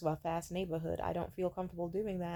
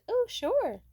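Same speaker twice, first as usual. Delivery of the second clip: very high, louder mock-eager reply; exaggerated high-pitched agreement; put-on acceptance; 6 dB louder than usual. Acts out her reply to offer.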